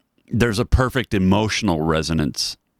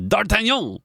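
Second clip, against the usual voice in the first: put-on French accent; 12 semitones higher than usual. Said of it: "in a French accent".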